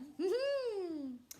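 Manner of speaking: hammed-up scheming titter; long falling evil giggle; sly fox chuckle.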